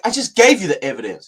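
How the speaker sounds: high, loud mock exasperation; irritated. Spokesman insisting to the reporter that he answered, irritated. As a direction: imitate an irritated official insisting.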